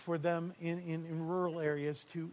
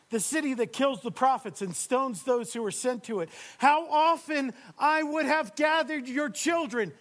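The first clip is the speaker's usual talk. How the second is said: higher, louder, aching plea; Jesus pleading; 8 semitones higher, 11 dB louder than usual. Acted. Jesus pleading with his people, loud aching plea. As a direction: lament over the city's lost children. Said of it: "Here he says".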